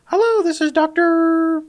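very high, slow chirpy receptionist. Retold or imitated. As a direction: sing it out like a front desk greeter. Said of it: "Someone answers".

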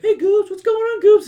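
high loud mocking taunt; sing-song teasing schoolkids; mocking kids. Classmates mockingly greet Goobs.

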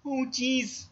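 very high cartoon teen fret; Morty impression. Shown as a demonstration. Friend coaching an impression, high anxious cartoon teen. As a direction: pitch it up like a nervous sidekick. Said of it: "Just do the".

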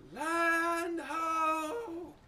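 high, long sung-out lookout call; bellowing over the wind. Acted. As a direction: bellow it from the crow's nest. Lookout calls from crow's nest.

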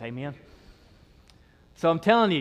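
ordinary talk, nothing marked

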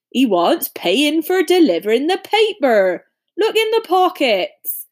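big gruff giant voice; Hagrid voice. A narrator's reading. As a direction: boom like a friendly giant.

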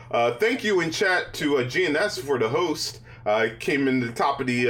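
ordinary talk, nothing marked

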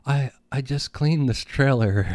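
a lightly played dying mother, fading. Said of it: "and she's like".

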